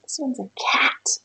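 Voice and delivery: hushed secret whisper. Real, not acted. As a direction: whisper it softly. Whispering word to child.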